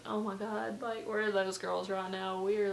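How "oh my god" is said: usual speaking voice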